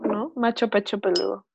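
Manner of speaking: ordinary delivery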